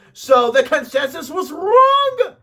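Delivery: high-pitched indignant protest; mock outrage. Host disputing the consensus verdict, hammed-up outrage. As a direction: build to a loud, drawn-out "wrong". Ranting that the verdict was wrong.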